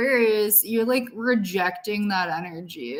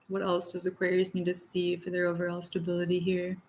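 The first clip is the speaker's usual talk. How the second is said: ordinary talk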